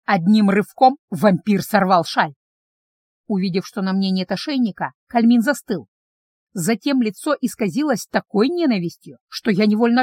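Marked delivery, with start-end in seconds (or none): none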